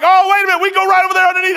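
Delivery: high, loud burst of acted objection; urgent protest. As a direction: jump in loudly to overrule them. Acts out his urgent objection to companions.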